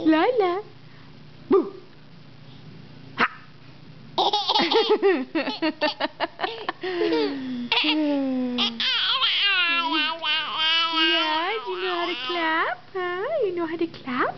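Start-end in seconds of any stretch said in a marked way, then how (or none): 4.2-11.0 long real laugh. Real, not acted. Mother laughing at baby.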